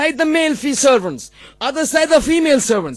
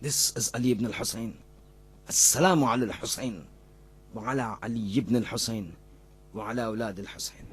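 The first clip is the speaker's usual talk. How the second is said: very low, soft voice, nearly spent; hushed, solemn preaching; 11 dB quieter than usual. Real, not acted. Preacher reproaching his own congregation after the story, hushed and solemn. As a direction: drop right down into a grave, subdued reflection.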